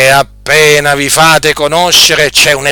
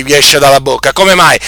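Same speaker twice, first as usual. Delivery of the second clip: ordinary talk, nothing marked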